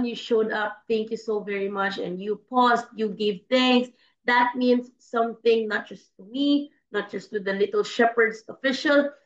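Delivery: ordinary talk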